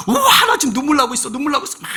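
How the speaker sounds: loud, high, hammed-up delight at own tears; mock excited. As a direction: crow over crying like a show-off. Mock churchgoer marvels at tears. (자기 눈물에 감탄하는 과장된 외침)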